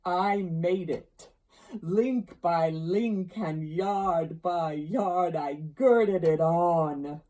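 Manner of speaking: loud, slow, drawn-out ghostly voice; solemn ghost. Acted. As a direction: toll each phrase out heavily, like a haunting spirit. Ghost's speech in narrated story.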